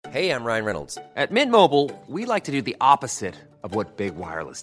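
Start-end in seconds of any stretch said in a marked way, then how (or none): none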